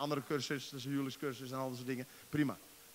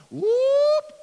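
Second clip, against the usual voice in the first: sudden loud high whoop; 16 dB louder than usual. Real, not acted. Preacher whooping in the middle of his point, loud and high. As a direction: throw out a joyful whoop. Exclamation in sermon.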